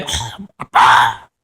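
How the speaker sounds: high, thrilled shout of good news; a father imitating his thrilled daughter's high shout; excited daughter shouting. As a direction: crow about the test score. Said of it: "막 저한테 막 소리 지르고".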